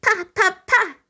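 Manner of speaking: put-on high babbling baby syllables. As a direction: babble like an infant. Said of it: "Morgan babbles".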